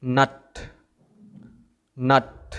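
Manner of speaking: "নাট" starting straight on n; silent G. Shown as a demonstration. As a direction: say gnat as "নাট", no g. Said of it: "এটার সঠিক উচ্চারণ হবে".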